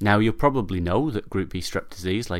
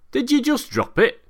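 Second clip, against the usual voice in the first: much higher, curious questioning voice; inquisitive pal; 5 dB louder than usual. Acted. Inquisitive neighbour questions drunk Arthur, high and curious. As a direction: ask it brightly, genuinely puzzled. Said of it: "asks his inquisitive pal".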